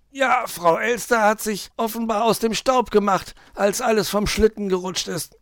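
high-pitched moan, building to loud complaint; groaning, fed-up fox. A narrator's reading. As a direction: moan about being left with the work. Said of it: "stöhnte Herr Fuchs". (stöhnender Fuchs, hoch und klagend)

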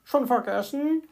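a hint of a chirpy, baffled bird; puzzled little bird. A narrator's reading. Little bird to the fox, puzzled. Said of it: "wunderte sich der kleine Vogel".

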